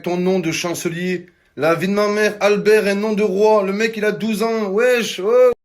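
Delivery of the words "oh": usual speaking voice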